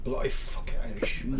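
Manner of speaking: soft, breathy put-on Irish brogue; mock Irish accent. Friend clowning in a fake Irish accent, muttered brogue. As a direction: growl it out like a pub Irishman, half-joking. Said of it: "I can be Irish".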